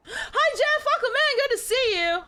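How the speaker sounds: cheery shop clerk. Acted. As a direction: play a bubbly clerk greeting a regular. Voicing cheerful clerk greeting.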